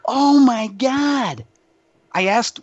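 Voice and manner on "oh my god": higher, drawn-out oh my god; slow, awed oh my god; stunned realization. Retold or imitated. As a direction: let it dawn on you slowly and in awe. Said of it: "And I'm like".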